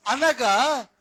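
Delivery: loud, slow, high preaching; fervent pulpit emphasis; preaching at full force. Acted. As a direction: drive the point home from the pulpit.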